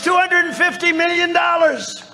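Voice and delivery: lightly voiced general, pleased with himself; proud general. General proudly quoting the fee to the president. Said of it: "So he says".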